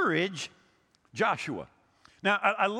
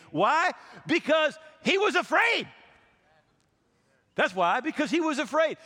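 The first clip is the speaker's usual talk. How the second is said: louder and higher, hammering home why; emphatic preaching; 6 semitones higher, 3 dB louder than usual. Real, not acted. Pastor pressing the congregation with the answer, louder. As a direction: drive the point home with force.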